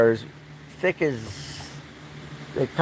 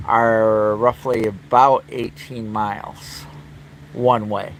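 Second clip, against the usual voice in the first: usual speaking voice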